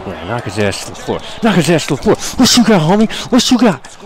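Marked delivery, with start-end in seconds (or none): 1.4-4.0 loud, high trash-talk; cheering his wrestler on, shouting; hype shouting. Real, not acted. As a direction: yell at the opponent to come on.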